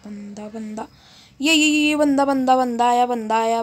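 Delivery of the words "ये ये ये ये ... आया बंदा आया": loud excited enemy warning; urgent callout. Real, not acted. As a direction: shout to your squad. Enemy spotted, calling out. (ज़ोर से चेतावनी भरी पुकार)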